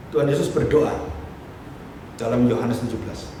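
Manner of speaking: ordinary talk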